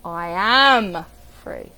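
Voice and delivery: "am" loud and drawn out, "free" dropping away; stress on AM. Shown as a demonstration. Coach demonstrating inflection, stress on "am". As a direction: lean long and hard on "am". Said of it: "when we use inflection".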